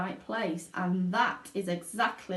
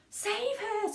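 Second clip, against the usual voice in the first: shrill, high panicked mother; a frantic mother screaming; 13 semitones higher than usual. A narrator's reading. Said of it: "screamed Missus Salt".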